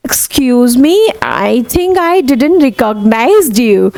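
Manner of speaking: drawn-out polite apology, very slow; slow demo. Shown as a demonstration. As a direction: say it slowly, politely. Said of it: "अब".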